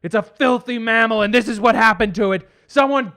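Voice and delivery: angry father. Acted. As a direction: snobbish dad demanding help. Father yelling.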